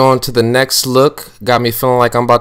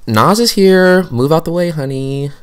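higher, louder diva announcement; sassy entrance; 7 semitones higher, 5 dB louder than usual. Acted. Nas barges into the voiceover with diva sass. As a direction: push in like you own the room. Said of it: "coming from Mel's voice, not Nas's mind".

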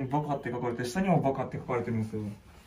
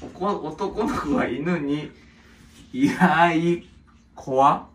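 slow, loud sounding-out of words; halting read-aloud; 4 dB louder, slower than usual. Real, not acted. Reading a child's writing for the others, slow and halting. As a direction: read it out bit by bit, unsure.

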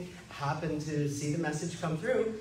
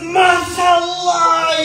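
booming drawn-out MashaAllah, much higher and louder; joyful shout; 13 semitones higher, 15 dB louder, much slower than usual. Retold or imitated. Bear hug greeting shout.

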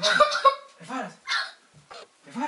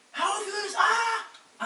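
higher shrill mock scream; demo scream; 12 semitones higher, much slower than usual. Shown as a demonstration. Planner acting out the victim's scream, higher mock shout. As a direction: act out her scream for her. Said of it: "Und dann".

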